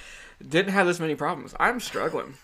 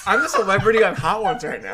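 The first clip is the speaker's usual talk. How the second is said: laughing; 2 semitones higher, 6 dB louder than usual. Real, not acted. Laughing through hot sauce pain.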